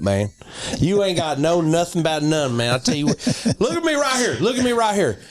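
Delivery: hammed-up US Southern accent. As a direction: sell it like a fired-up Southerner. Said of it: "Southern, U S, English, Southern".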